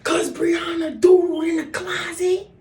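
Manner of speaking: high, loud whiny tattletale voice; tattling little brother. Retold or imitated. Little brother telling mom who did it, high loud whine. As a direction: snitch on your sister in a high whine. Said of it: "Here's my little brother".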